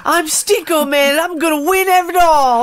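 high, loud cartoon boy voice; high cute anime voice. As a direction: chirp it like a cocky anime kid. Playful cartoon role-play.

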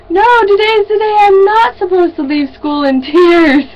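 high-pitched mock wail; exaggerated whiny outburst; mock tearful protest. Retold crying after school.

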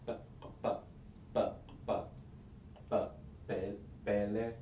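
isolating "p, p, p" then building to "bele"; sounding out the kp. Shown as a demonstration. Teacher breaks the kp sound into pieces for learners. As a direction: drill the stop sound by sound. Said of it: "it's a K plus a P".

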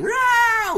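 high, loud drawn-out roar; screaming. Retold or imitated. Andrew charges at Rick with an axe, screaming. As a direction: scream it out like an attacker. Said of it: "Andrew's, like, screaming".

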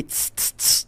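short hissing air-horn bursts; imitated bus horn. Storyteller showing friends the bus's honk, imitated horn hiss. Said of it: "A buzina é essa porra".

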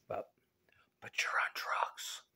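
soft secret whisper; teasing whisper. Pretend.

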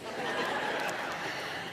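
breathy laugh. Retold or imitated. Caller laughs on the phone.

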